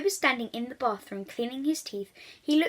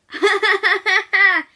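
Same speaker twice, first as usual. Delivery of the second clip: laughing; 9 semitones higher, 11 dB louder than usual. Acted. Children's laughter in story.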